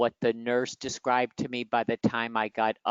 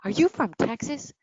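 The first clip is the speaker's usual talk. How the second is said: quoting a stranger; 9 semitones higher than usual. Retold or imitated. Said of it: "She goes".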